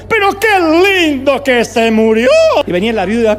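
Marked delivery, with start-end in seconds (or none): 0.1-2.3 loud, slow, high jubilant proclamation; Juan Carlos Ortiz. Retold or imitated. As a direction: gleefully boom it out, stretching every word. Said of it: "siempre entraba y decía".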